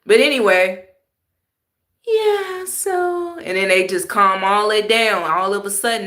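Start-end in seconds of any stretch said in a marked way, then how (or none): 2.1-3.4 a high bright mocking yeah; mock animated voice. Mocking the fake animated people, high. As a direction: parody their sing-song. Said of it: "mock the way that they talk".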